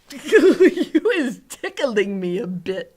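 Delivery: loud giggling giant, slowed by laughter; ticklish laughter. A narrator's reading. Giant tickled by the girl in his ear, laughing loudly. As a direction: giggle through the words as if tickled.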